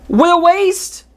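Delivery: sudden high cry of dismay; exasperated outburst. Real, not acted. Reviewer crying out at a wasted character, high and exasperated. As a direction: throw up your hands at the waste.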